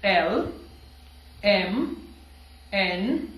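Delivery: clear, separate letter names; letters modelled. Shown as a demonstration. Teacher models letters for students to repeat. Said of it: "मेरे साथ दोहराइएगा".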